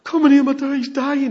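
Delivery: high, loud begging; urgent plea. Retold or imitated. Father begging Jesus to come, loud urgent plea. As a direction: cry out for help.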